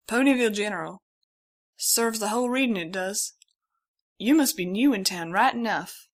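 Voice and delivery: a fatherly country stallion insisting; the stallion urges her to the hospital; folksy stallion. A narrator's reading.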